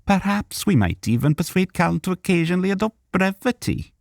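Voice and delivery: very high, wry voice; Arundel. A narrator's reading. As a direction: tease Cal dryly. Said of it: "Arundel agreed".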